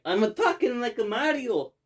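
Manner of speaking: Luigi. Retold or imitated. Host quoting the baffling Mario line, imitating Luigi. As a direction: put on a high mock-Italian character voice. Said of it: "When Luigi's like".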